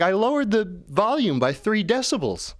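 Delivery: high, put-on reporting voice; AI assistant voice. AI reporting the volume change, high mock-helpful. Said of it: "he's like".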